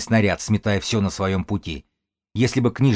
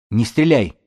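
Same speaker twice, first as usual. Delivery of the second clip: raised-voice warning cry; urgent shout; 2 semitones higher, 4 dB louder than usual. A narrator's reading. Белка warns against shooting, shouting urgently. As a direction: call out to stop someone firing. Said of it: "крикнула Белка".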